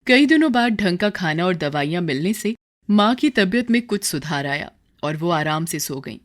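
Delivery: plain reading voice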